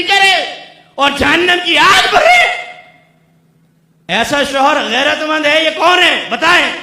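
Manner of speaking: loud, high-pitched preaching at full force; fiery preaching. Acted. Preacher scolding husbands who unveil their wives, roaring. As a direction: thunder the rebuke at the congregation.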